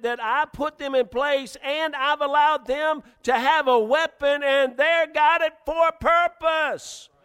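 high, loud preacher's shout as God; insistent preaching. Acted. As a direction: build to a slow, shouted finish. Preacher voices God insisting, building.

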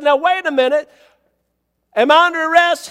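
high, loud demand for an answer; retold loud protest. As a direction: confront the officer in a retelling.

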